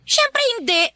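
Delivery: high, shrill-pitched denial; indignant protest. Acted. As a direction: jump way up in pitch, playing offended. Stepmother protesting to husband.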